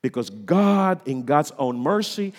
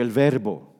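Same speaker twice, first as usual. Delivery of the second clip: usual speaking voice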